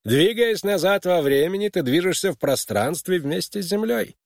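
high, insistent mentor's argument; objecting mentor. A narrator's reading. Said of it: "ответил на его возражение Ярроу".

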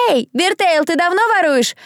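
high, jeering call; mocking taunt. A narrator's reading. Crowd member to accused thief, mocking call. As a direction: heckle from the crowd.

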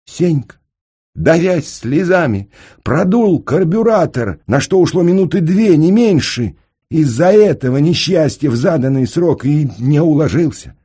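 high, loud, distressed narration; tearful agitation. A narrator's reading. Narrator tells of Senka fixing the carburetor, high and tearfully agitated. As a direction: tell it as if choking on tears.

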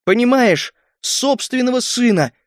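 high-pitched, anguished insistence; grieving, indignant high cry; pained outcry. A narrator's reading. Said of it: "с болью в голосе выкрикнула Галия".

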